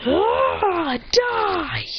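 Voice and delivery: high-pitched drawn-out battle yell; battle cry. Acted. Hero wrecking robots, high-pitched acted battle cry. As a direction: yell it like an anime attacker.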